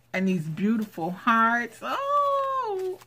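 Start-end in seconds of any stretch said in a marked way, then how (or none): 1.8-3.0 very high, long "oh" of delight; excited squeal. Real, not acted. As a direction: squeal a drawn-out oh. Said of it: "I'm just so excited".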